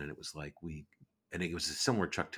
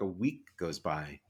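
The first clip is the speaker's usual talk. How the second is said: ordinary talk, nothing marked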